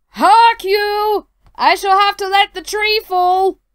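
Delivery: loud high giant cry; booming strained giant. Acted. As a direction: bellow it like a giant at his limit. Giant strains under the tree.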